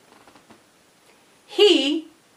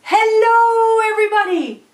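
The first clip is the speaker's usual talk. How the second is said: screamed greeting; 8 semitones higher, 3 dB louder than usual. Acted. Boy on horseback to the audience, high screamed greeting. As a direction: holler it to a whole crowd. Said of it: "And he screamed".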